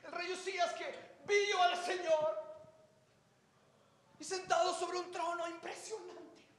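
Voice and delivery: breathless prophet spilling out what he saw, pitched high; high, wide-eyed telling of the throne vision; Isaiah telling it. Acted. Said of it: "Isaías de repente estaba ahí parado y dijo".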